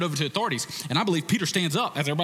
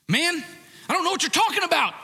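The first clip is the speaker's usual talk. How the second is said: loud, much higher, fast denial shout; Peter's angry denial; 4 dB louder, faster than usual. Retold or imitated. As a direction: yell it, defensive and heated. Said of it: "you have Peter".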